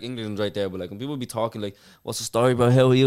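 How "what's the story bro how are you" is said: lightly mocking Irish guy faking UK slang; mock Irish-English mix. Mocks Irish people putting on a UK accent. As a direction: imitate a try-hard UK accent. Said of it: "English is like".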